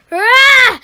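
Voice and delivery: loud high startled gasp; startled cry. Acted. Boy seeing the open shed, startled loud cry. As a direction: jolt at the sight.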